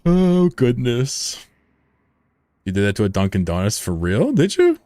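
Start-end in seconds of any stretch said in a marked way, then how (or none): 0.0-1.5 slow, drawn-out gasp of shock; overdone surprise; mock shock. 4.3-4.8 squeaky high disbelief; incredulous. Pretend. Mock incredulous question.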